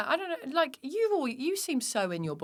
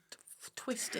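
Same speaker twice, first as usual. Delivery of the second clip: whispered aside; 8 dB quieter than usual. Real, not acted. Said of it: "Drives me twisted".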